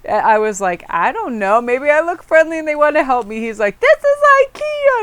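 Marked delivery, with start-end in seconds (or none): 0.9-3.4 high, louder playful innocence; innocent shrug. Retold or imitated. Her shrugging reply in story. 3.8-5.0 shrill mock outrage, far higher and louder; hammed-up indignant wail; meltdown. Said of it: "he had, like, a meltdown over it".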